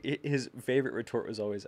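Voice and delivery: usual speaking voice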